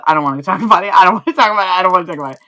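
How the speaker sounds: loud laughing protest, over and over; half-laughing loud refusal to go into it; embarrassed shouting. Real, not acted. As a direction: yell it out, laughing and mortified. Embarrassed over texting a bot.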